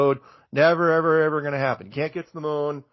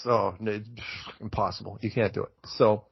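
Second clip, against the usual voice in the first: normal talking voice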